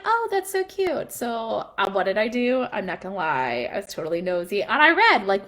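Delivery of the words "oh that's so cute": high-pitched, drawn-out coo; acted-out sweet gushing. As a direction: coo over something adorable. Gushing over child's wishes diary.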